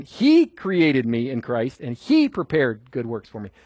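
both "He" and "he" lifted above the rest; stress on He. Shown as a demonstration. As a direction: punch "He" and "he", let the rest fall. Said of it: "It's all about him".

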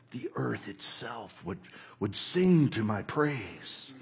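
Jesus retold. Preacher retelling Jesus's reply, low and soft. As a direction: murmur it low and grave. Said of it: "he was like".

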